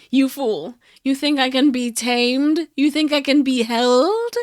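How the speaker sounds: loud, gloating supervillain voice; villain taunt. Pretend. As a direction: sneer it like a captured villain. Mock villain taunting captors.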